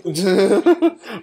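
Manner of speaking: a big burst of laughter; real amused laugh; loud laugh. Real, not acted. Reactor laughing at Mole.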